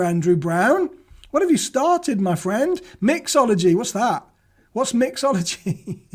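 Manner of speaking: ordinary talk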